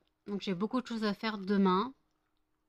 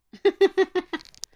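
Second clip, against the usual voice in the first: high burst of laughter; 10 semitones higher, 11 dB louder than usual. Real, not acted. Laughing at the dog.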